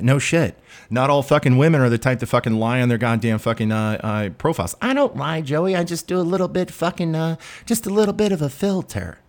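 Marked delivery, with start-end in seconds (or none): 4.8-9.2 high-pitched imitation of a defensive woman; mock woman. Woman excuses her filters to Joey, mocking high-pitched imitation. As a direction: mimic her excuses in a much higher voice.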